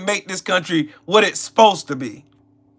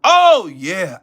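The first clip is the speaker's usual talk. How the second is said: ordinary delivery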